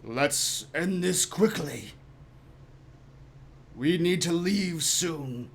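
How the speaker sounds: slow, drawn-out sinister voice; mysterious figure in the corner. Acted. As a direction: speak slowly from the shadows. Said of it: "you hear a voice".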